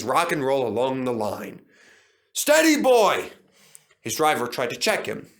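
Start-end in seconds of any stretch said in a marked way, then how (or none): 2.3-3.3 loud high shout of caution; warning call. A narrator's reading. Driver checking swaggering Duncan, loud call. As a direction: rein him in urgently.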